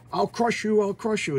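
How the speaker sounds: high-pitched mock threatening cry; acted threat. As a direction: play out a frantic villain's threat. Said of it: "she's freaking out".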